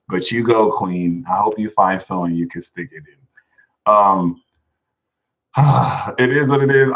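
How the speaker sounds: normal talking voice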